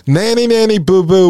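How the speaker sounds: mock the listener like a gloating child; mocking taunt. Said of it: "'Cause they're saying".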